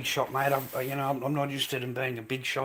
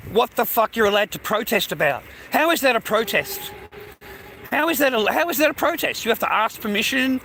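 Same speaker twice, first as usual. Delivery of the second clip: rising higher with each repeat, loud; indignant rant; 7 semitones higher, 4 dB louder than usual. Real, not acted. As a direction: hammer the question home in outrage. Ranting about protest laws.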